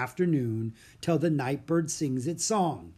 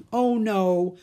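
loud, high cry of dismay; alarmed dismay; 7 semitones higher, 6 dB louder than usual. Acted. Jack seeing the bird get loose, alarmed. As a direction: exclaim as it all goes wrong.

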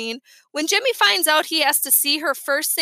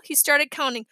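ordinary delivery

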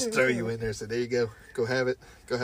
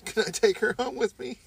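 mock pleading; 11 semitones higher than usual. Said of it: "be like".

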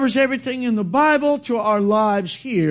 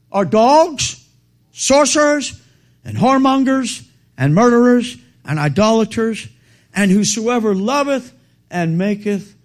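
louder, each sin hammered out in turn; proclaimed scripture; 3 semitones higher, 5 dB louder than usual. Real, not acted. Preacher to congregation reading Revelation, loud proclamation. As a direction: call out the list like a judgment.

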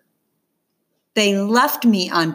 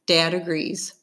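usual speaking voice

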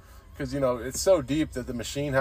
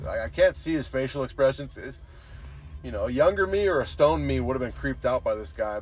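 ordinary delivery

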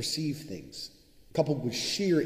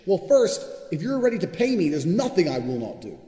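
much higher, louder insistence; heated shout; 6 semitones higher, 7 dB louder than usual. Real, not acted. Telling listeners how to get rich, shouting. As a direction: hammer the demand home. Ranting about getting paid.

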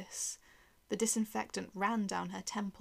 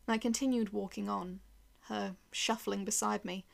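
plain reading voice

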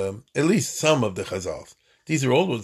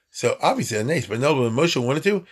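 ordinary talk

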